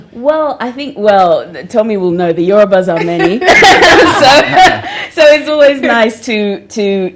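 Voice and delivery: giggly, amused answer; laughing. Real, not acted. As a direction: laugh while making the joke. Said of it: "laughs on these lines".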